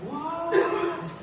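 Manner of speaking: high, drawn-out mock disbelief; stunned reaction. A listener reacting to random stories that click, amazed. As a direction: react with an exaggerated high-pitched what.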